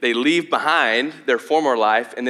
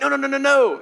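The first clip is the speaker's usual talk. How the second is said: much higher and louder, a frantic string of nos; Peter's alarmed protest; 14 semitones higher, 4 dB louder than usual. Retold or imitated. Peter refusing to hear that Jesus will die, frantic high protest. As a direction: panic at the news and cut him off.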